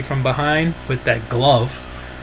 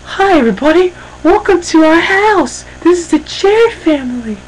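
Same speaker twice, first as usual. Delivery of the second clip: mock Karen greeting; 4 dB louder than usual. Karen welcomes viewers to the house, in a mock high hostess voice. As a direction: play the gushing TV hostess showing off her house. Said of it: "opens the door and goes".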